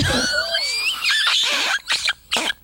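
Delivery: very high shrieking wheeze; wheezy wheeze. Retold or imitated. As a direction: wheeze like he needs an inhaler. Wheezing old man sound.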